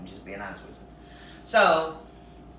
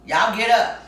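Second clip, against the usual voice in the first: loud command; 6 semitones higher, 8 dB louder than usual. Retold or imitated. Brother waking them up.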